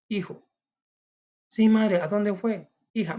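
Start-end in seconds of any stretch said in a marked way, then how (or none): 1.5-2.7 put-on higher, louder child's voice asking; a child startled by mother crying; worried child. Child asks weeping mother why, higher, louder worried voice.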